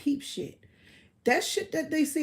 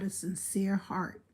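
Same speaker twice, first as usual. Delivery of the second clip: soft whisper; 3 semitones lower, 8 dB quieter than usual. Real, not acted. Trailing into a whisper.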